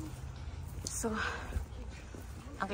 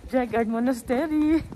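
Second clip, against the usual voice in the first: bright, raised announcer welcome; excited welcome; 5 semitones higher than usual. Real, not acted. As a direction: welcome viewers with energy. Vlog announcement.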